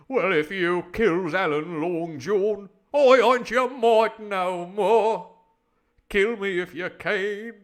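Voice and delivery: very loud, slow declaiming; defiant sailor. Acted. Tom renouncing Long John, loudly defiant. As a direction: stretch each word into booming defiance.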